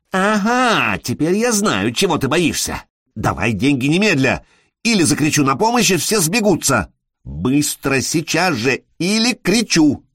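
agitated blackmailer threatening a scene; hysterical threat. Acted. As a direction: press him hard, pitch climbing. Near-hysterical woman threatens to scream.

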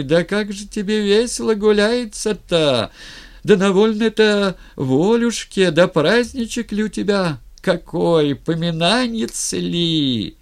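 high, loud keening lament; old village keener howling in singsong; wailing old wailer woman. A narrator's reading. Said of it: "завыла слышней запева атаманского".

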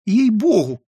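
high-pitched, pleading little oath; voiced defensive character; defensive protest. A narrator's reading. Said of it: "защищался Филюрин".

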